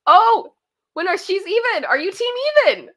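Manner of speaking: high, loud surprised question; a real jolt of surprise at the count. Real, not acted. Surprised at team even.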